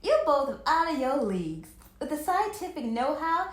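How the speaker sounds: loud know-it-all retort; loud, superior comeback; snapped. A narrator's reading. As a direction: snap it back loudly. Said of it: "Mel snapped".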